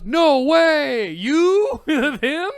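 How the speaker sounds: loud, very high mock amazement; slow, drawn-out exclamation imitating sheer disbelief; starstruck disbelief. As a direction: shriek it like an astonished fan. Acting out the doctor's starstruck disbelief.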